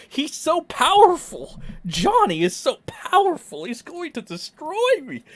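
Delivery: high, hammed-up terrified complaint; exaggerated high-pitched parody of helplessness; mock victim. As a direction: wail it in pretend dread, mocking. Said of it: "Amber Heard defense".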